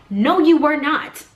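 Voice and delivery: loud, high, slow retort; firm contradiction. Retold or imitated. Mom contradicts the irate woman, forceful. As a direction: flatly refuse her claim, with force.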